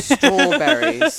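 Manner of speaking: loud, high, drawn-out hammed-up British; Austin Powers British. Shown as a demonstration. Host fails at guest's word, hammed-up British accent. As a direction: overdo the British accent through laughter. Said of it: "I sound like Austin Powers".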